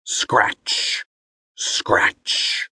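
breathy whispered sound effect; whispered scratching. A narrator's reading. Narrator whispering the scratching at the door.

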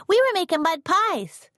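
put-on high, eager child's voice; little girl. A narrator's reading. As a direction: chirp it like a proud kid. Katie's voice continues.

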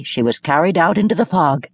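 ordinary delivery, nothing marked

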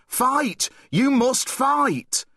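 high insistent cry, slowing on the end; urgent command. Acted. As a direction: urge him to fight back. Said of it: "she cried".

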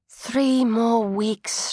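slow, drawn-out sigh of a thought; weary inner thought. A narrator's reading. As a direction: count the weeks slowly, worn out. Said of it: "she thought".

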